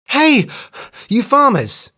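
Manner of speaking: high, loud call across the field; hare calls out. A narrator's reading. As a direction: hail the farmers urgently. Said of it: "she cried".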